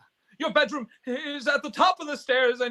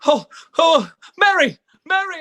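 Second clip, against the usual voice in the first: excited calling; 4 semitones higher, 7 dB louder than usual. Acted. George bursts home calling.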